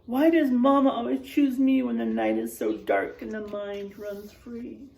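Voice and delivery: ordinary delivery, nothing marked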